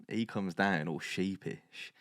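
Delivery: usual speaking voice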